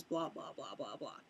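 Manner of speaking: low, soft, fading string of blahs; tossed off quietly, pitch dropping; dismissive trailing blahs. Real, not acted. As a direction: let it sink away into a low mumble. Skims over the rest of plot.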